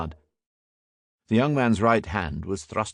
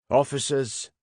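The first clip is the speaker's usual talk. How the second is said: higher-pitched address; reproving constable; 6 semitones higher than usual. A narrator's reading. Constable steps in for his inspector, reproving. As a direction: take charge of junior officers.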